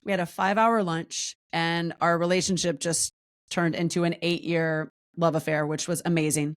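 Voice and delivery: ordinary talk, nothing marked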